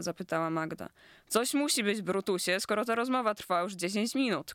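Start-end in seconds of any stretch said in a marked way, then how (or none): none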